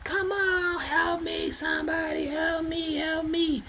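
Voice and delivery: high, soft falsetto pleading; imitating her cries for help. A woman calling to passing cars for help, in high falsetto. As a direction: beg for help in a thin high voice. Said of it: "I'm seeing that she's like".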